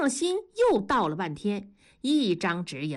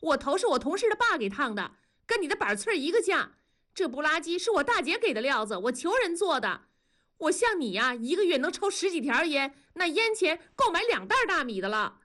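higher and faster, heated wife; angry; 8 semitones higher than usual. A narrator's reading. Wife flaring up at her husband over his cigarettes, fast and angry. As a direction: rattle off the defence in a fast temper. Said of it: "文丽就火了".

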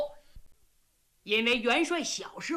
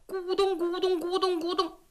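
gulping sounds; 5 semitones higher than usual. Acted. Drunk general chugging wine from the jar, gulping sounds. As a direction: mimic greedy gulping from a jar.

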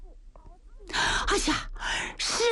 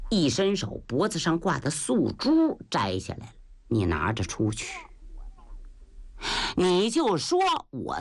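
put-on old master; 5 semitones lower than usual. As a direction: speak like a calm, aged master. Old master instructs Yue Ba. (老师父低沉缓慢地说话)

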